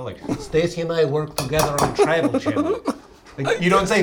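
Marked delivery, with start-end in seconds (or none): 0.4-2.8 broken English. Retold or imitated. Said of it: "sounded like someone who doesn't speak English well".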